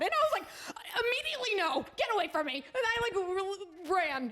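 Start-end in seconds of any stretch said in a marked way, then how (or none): 0.9-2.6 high-pitched retold refusal; emphatic rejection. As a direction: push him away, voice pitched up. Retold rejection of snake man.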